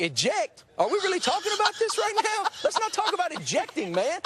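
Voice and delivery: high, fast, panicky protest; freaking out about ejecting, hammed up; mock panic. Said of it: "I'm like".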